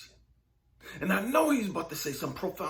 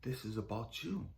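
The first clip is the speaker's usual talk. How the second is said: low, quiet, patient reply; hushed, grave correction; God; 12 semitones lower, 9 dB quieter than usual. Retold or imitated. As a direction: answer softly and deep, pausing between sentences. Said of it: "And he said".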